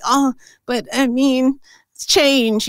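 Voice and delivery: high, slow imitation of reluctance; mock hesitant. Taurus friend balking at change, mock hesitant.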